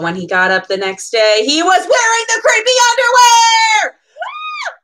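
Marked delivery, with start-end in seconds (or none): 1.5-4.7 high, loud horrified shout; shocked scream. A narrator's reading. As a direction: shriek at the shocking reveal. Shocked reveal in story.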